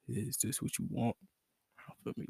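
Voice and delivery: low, soft sultry ask; seductive voice. Shown as a demonstration. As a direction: breathe it softly like a tease. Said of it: "let me get the voice right".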